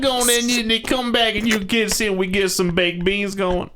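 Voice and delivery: loud, very high Southern hillbilly rant; hammed-up hillbilly holler. Shown as a demonstration. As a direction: ramble shrilly in an exaggerated backwoods Southern accent. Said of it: "just straight up hillbilly".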